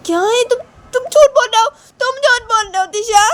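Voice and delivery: very high, loud wailing accusation; furious little girl. Acted. Shanaya calls Tisha a liar, wailing. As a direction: wail the accusation in a child's voice.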